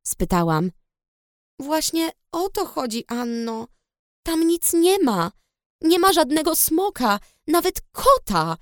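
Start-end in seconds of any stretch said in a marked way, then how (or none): 1.6-8.6 a sister raised and firm, leaning on the words; said loudly. A narrator's reading. The blonde twin insists there is no dragon, raised and insistent. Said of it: "powiedziała głośno blondynka".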